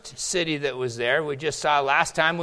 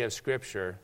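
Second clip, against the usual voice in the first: ordinary talk, nothing marked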